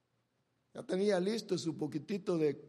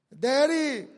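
a hint of a boy calling up to his father, louder and higher; 6 semitones higher, 10 dB louder than usual. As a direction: call out Daddy like the young son. Isaac calls his father.

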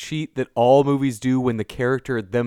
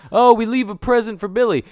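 chirpy, higher-pitched mock cheer; oddly cheerful mimicry, pitched up; oddly happy; 6 semitones higher than usual. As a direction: mimic blithely happy people, pitch lifted. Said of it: "everybody's oddly happy about".

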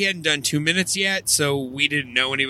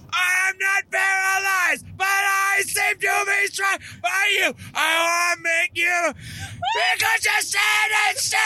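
very high, slow scream-singing; imitated drunken screaming. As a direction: scream the lyrics like a person, not a metal singer. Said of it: "like human person screaming".

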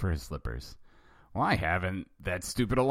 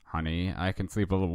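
plain reading voice

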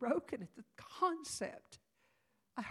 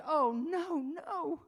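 panicked; 7 semitones higher, 7 dB louder, much slower than usual. Retold or imitated. Mother's panic in retold scene.

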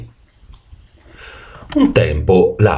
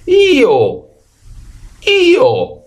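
donkey bray. A narrator's reading. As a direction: bray back eagerly to the farmer. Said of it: "rispose l'asino". (raglio d'asino che risponde)

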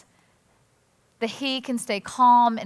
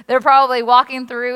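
normal talking voice

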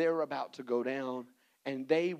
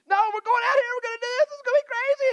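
shrill falsetto, much higher and louder; excited party friends; 11 dB louder, faster than usual. Acted. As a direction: squeal it like thrill-seeking friends.

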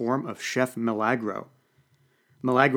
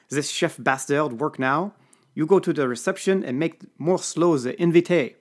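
put-on urgent French accent; 5 semitones higher, 4 dB louder than usual. A narrator's reading. Serge sends McGregor to delay the guests, French accent. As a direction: press him in broken French English. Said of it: "Serge instructs McGregor".

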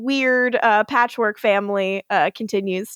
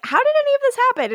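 high fast quote; much faster than usual. Retold or imitated. Rebecca quoted.

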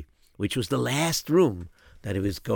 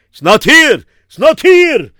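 high panicked cry; panicked shout; 14 dB louder than usual. Acted. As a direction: shout it in alarm. Rav panics at the missing pouch.

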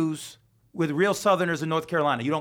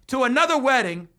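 ordinary talk

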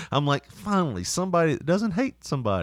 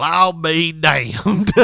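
slow drawn-out Southern amazement, a bit louder; Southern drawl; 3 semitones higher, 6 dB louder, much slower than usual. Retold or imitated. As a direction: stretch it out like a burly Southern trucker. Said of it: "just as burly and just as Southern".

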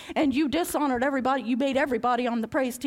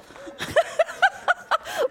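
high squealing laugh; shrieking laugh. Real, not acted. Big laugh.

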